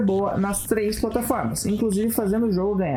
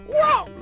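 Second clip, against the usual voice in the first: excited whoa; 4 dB louder than usual. Retold or imitated. Crash's exclamation voiced.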